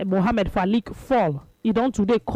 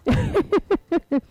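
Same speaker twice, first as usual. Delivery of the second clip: laugh; 10 semitones higher than usual. Real, not acted. Reacting to listener's text.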